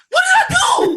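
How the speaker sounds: loud, high, laughing shock; shocked disbelief. Retold or imitated. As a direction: yelp it in disbelief. Shocked reply to agent.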